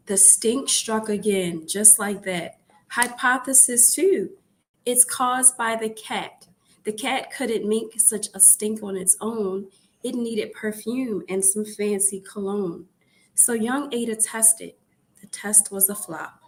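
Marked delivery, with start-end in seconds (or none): none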